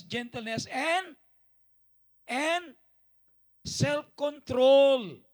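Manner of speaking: loud, soaring Bible reading, slowing at the end; scripture reading. Acted. Pastor reading Galatians to the church, proclaiming loudly. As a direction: proclaim each fruit of the Spirit.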